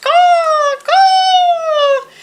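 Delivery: high, loud, long caws; croaking crow caws. A narrator's reading. Narrator voices crows disturbing Owl, high loud caws. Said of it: "The crows croaked".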